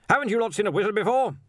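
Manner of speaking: quick, high, irritable challenge; snapping. A narrator's reading. Arch-Chancellor challenges staring watchmen, snapping. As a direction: snap at the onlookers. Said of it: "he snapped".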